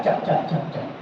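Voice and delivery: whispered smacking chap chap of open-mouth chewing; noisy chewing sound. Shown as a demonstration. Teacher mimics rude eating for students, whispered smacking. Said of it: "Do not open your mouth and do chap".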